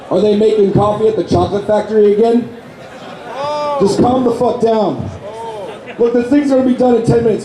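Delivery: ordinary talk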